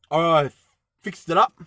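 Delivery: ordinary talk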